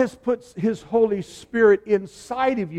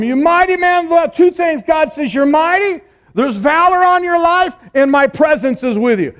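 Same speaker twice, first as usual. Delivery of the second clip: bold proclamation; 9 semitones higher, 9 dB louder than usual. Acted. Angel proclaims Gideon's calling.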